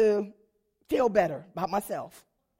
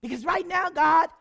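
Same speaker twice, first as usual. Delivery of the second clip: higher and louder cry to God; impassioned plea; 7 semitones higher, 7 dB louder than usual. Real, not acted. [name] confessing to God she feels worthless, loud plea. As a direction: plead it out with feeling.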